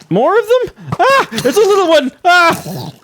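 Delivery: high, loud panicky squeak; panicked squeal. Pretend. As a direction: squeal in alarm at the mobs. Mobs keep coming at him.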